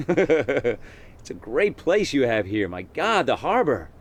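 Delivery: high, slow put-on American tourist voice; gushing tourist in an American accent. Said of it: "thought I was from America".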